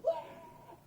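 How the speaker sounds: high, imitated cry of alarm; mock panicked wail. Campers running behind trees, mock panicked wail. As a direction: yelp it high as if fleeing.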